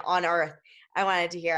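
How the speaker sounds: ordinary delivery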